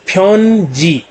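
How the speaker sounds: फ्योनजी split into a long phyon and jee; two slow syllables. Shown as a demonstration. Teacher repeats the two-syllable word, each syllable held long. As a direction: hold each half of फ्योनजी. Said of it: "दो syllable से".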